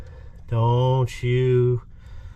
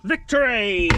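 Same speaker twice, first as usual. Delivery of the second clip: triumphant; 6 semitones higher, slower than usual. Real, not acted. Part finally comes free.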